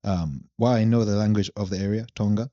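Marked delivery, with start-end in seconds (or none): none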